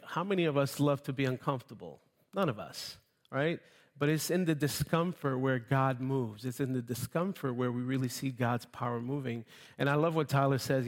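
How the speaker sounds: usual speaking voice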